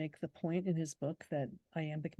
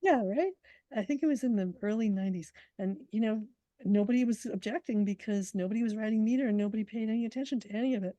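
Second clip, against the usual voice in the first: animated; 4 semitones higher, 7 dB louder, faster than usual. Real, not acted. Lively agreement after laugh.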